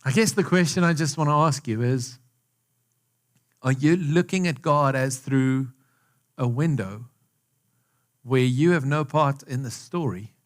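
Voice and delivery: normal talking voice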